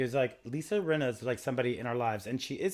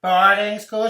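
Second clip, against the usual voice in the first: louder, slow, high clerk voice; mock prissy clerk; 6 semitones higher, 12 dB louder, slower than usual. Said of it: "he's like".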